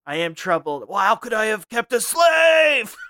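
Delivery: Watto's anguish building to a hammed-up cry; brooding Watto. Reboot Watto confesses his guilt to co-host, hammed-up shout. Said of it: "I'm Reboot Watto".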